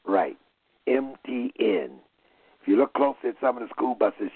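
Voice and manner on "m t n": spelled out slowly. Shown as a demonstration. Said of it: "let's be real clear".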